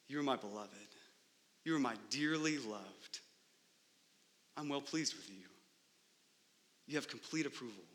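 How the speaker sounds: soft, low and tender; tender fatherly voice. Acted. God the Father's tender words.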